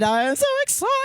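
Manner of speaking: excited, building. Shown as a demonstration. Host announcing the show, excitement climbing. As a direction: let the thrill rise.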